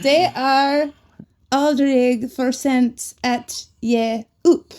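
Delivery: 'Det är aldrig för sent att ge upp' spoken very slowly; 'att ge upp' drawn out with 'ge' as 'ye'; slow careful Swedish. Shown as a demonstration. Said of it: "slow full phrase".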